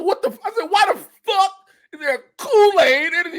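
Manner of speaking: high, loud disbelief; incredulous. Retold or imitated. Comedian reliving finding Kool-Aid energy drink, high incredulous outburst. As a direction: pitch it way up, astounded.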